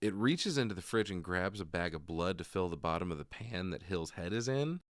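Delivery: ordinary delivery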